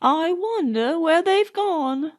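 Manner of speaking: put-on a fretting old nurse; old Nanny. A narrator's reading. Nanny wondering to Burns, a hint of jealousy. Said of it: "said Nanny".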